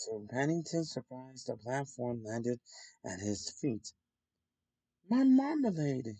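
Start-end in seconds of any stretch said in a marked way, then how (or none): 5.1-6.2 high, delighted cry of relief; thankful exclamation. A narrator's reading. A bear cries out thankfully on finding his marmalade. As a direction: burst out with grateful joy. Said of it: "he exclaimed thankfully".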